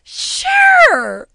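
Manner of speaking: high, loud strained "sure"; fake agreement. Telling her boss a forced yes. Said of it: "And I was like".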